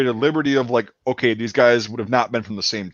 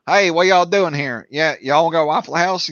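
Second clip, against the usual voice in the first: broad Tennessee twang, pitched up; Western Tennessee accent; 3 semitones higher than usual. Retold or imitated. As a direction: hail them in thick Western Tennessee. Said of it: "Western Tennessee, yeah, they've got that".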